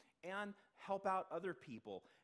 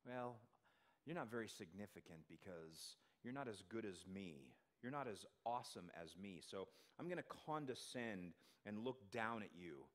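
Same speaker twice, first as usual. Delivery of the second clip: condescending; 8 semitones lower, 6 dB quieter than usual. Retold or imitated. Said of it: "Have you ever faced that from others?".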